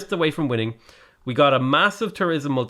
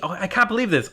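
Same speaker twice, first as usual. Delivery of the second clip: ordinary talk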